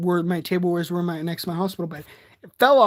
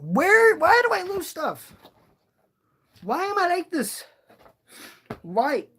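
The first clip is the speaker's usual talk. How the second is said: frustrated whine; 10 semitones higher than usual. Real, not acted. Frustrated whine losing item.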